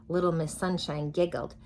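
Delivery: ordinary delivery, nothing marked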